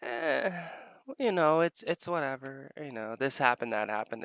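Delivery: mild mock indifference; dismissive mimic. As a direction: brush it off like it's nothing. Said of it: "She's like".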